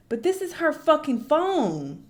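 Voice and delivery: slow, loud, disbelieving; stunned at the fan's phone contact name; incredulous outburst. Real, not acted. Reaction to his texts, slow and loud.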